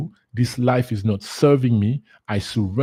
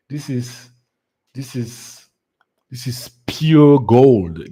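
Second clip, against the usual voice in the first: awed; 6 dB louder, slower than usual. Real, not acted. Delighted by the mantra.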